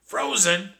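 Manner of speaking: very high, loud shocked squeak. Acted. Narrator learns clams were frozen, shocked squeak. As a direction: squeak it in disbelief. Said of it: "I squeaked".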